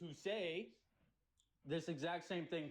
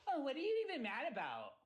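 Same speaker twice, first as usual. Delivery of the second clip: mocking quote; 7 semitones higher than usual. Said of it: "you come up and go".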